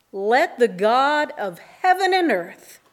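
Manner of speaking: ordinary talk, nothing marked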